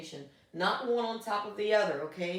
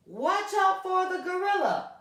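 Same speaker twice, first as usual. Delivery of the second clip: high, raised-voice yell; exclaimed warning; 11 semitones higher, 4 dB louder than usual. Shown as a demonstration. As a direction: call it out as an exclamation. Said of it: "exclamation point".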